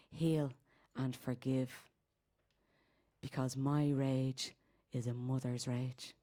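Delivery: low, soft recital with long pauses; spoken-word incantation. Acted. Speaking of a mother's rage, hushed and solemn.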